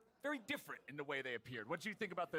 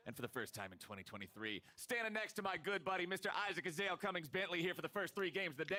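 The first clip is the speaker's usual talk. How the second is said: announcer voice; 5 semitones lower, 15 dB louder than usual. Acted. Caster opens the broadcast.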